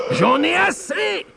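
very high, drawn-out yell; fed-up, top-of-the-voice wolf howl. Acted. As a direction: scream it as the exasperated wolf. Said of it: "hurla-t-il".

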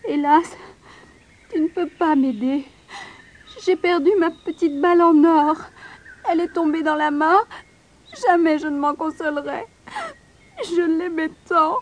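a mild sob on the last words; weeping princess. A narrator's reading. Princess mourning her ball to the frog, tearful. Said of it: "elle se remit à pleurer".

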